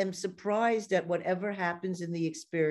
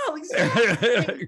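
louder, slow laughing no, exactly; 7 dB louder, slower than usual. Real, not acted. Host agreeing with guest, laughing.

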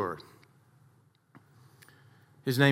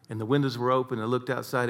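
usual speaking voice

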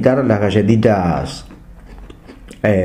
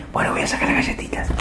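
hushed, hurried whisper; furtive whisper; 9 dB quieter, faster than usual. Acted. Moviegoer trying not to make noise in the cinema, furtive whisper. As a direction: whisper it as if sneaking out a snack. Acts out opening cookies quietly in cinema.

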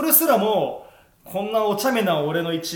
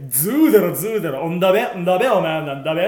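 exaggerated, loud Yamagata dialect; mock Yamagata dialect; 6 dB louder, faster than usual. Teasing a senior for slipping into hometown talk, mock Yamagata dialect. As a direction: mimic a thick Yamagata accent, bursting out louder. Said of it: "めちゃめちゃなまってんだぜ".

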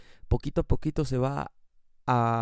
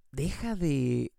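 ordinary talk

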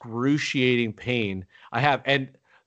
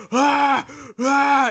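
imitated anime pain cry "ah, ah", much higher and louder; hammed-up straining cry like an anime fighter; Dragon Ball Z pain cry; 12 semitones higher, 6 dB louder than usual. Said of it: "it was almost like Dragon Ball Z".

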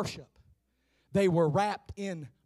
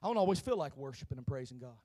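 quick aside; 4 semitones lower, 6 dB quieter, faster than usual. Shown as a demonstration. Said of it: "I'm gonna say it again".

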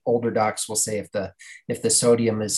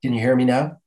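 louder, checking the line; speaking up; 2 semitones higher, 5 dB louder than usual. Real, not acted. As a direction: raise your voice over a bad connection. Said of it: "I'm not hearing you".

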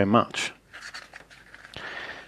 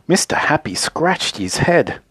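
ordinary delivery

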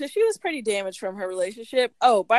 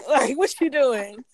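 loud, slow, laughing boss voice, pitched up; mock boss scolding; 5 semitones higher, 8 dB louder than usual. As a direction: ham up a bossy owner telling off a worker. [name] scolding [name] at work.

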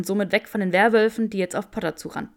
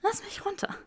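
gasping, higher whisper; breathless whisper; 13 semitones higher, 8 dB quieter than usual. A narrator's reading. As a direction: gasp it out weakly. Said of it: "keuchte Granger".

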